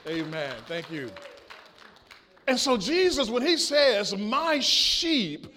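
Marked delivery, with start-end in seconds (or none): 2.5-5.5 loud, raised, emphatic pulpit delivery; preaching. Acted. Pastor returns to Jesus and his sheep, emphatic preaching. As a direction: drive the verse home to the congregation.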